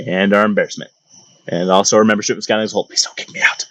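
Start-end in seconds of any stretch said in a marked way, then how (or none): 2.9-3.7 whispered aside. Pretend. Aside pleading to scouting.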